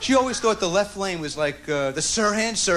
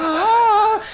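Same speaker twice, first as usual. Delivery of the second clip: high, louder startled ah; grandma's gasp; 12 semitones higher, 5 dB louder than usual. Retold or imitated. Grandson imitating grandma finding things, high startled cry. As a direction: yelp like she's found something. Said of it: "She went".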